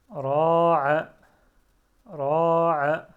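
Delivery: loud, careful model reading of Ta'ala for pupils; Ta'ala said loud and clear, each syllable full. Shown as a demonstration. Teacher reading aloud to learners, loud and careful. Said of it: "teacher models Arabic reading".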